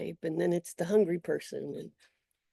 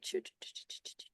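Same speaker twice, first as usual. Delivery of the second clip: rapid voiceless whispered puffs; whispered choo-choo noise; 9 dB quieter, much faster than usual. Shown as a demonstration. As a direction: whisper a quick train-like sound, trailing away. Imitates attention darting into moment.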